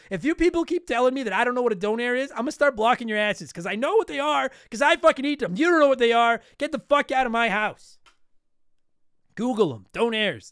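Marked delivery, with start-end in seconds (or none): 0.1-8.0 louder and higher, hammed-up furious threat; mock angry rant. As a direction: yell it like an over-the-top fed-up host. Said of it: "I'm so sick of people giving me shit".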